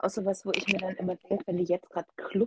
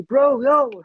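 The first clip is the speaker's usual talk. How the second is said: youth slang voice; 4 semitones higher, 8 dB louder than usual. Retold or imitated. Imitates youth slang speakers.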